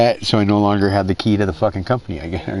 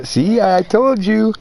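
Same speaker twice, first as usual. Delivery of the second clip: higher, slow drawn-out gloat; smug told-you-so; 9 semitones higher, slower than usual. Retold or imitated. Telling his friend he warned him, smug and drawn out. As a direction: stretch it out smugly. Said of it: "I was like".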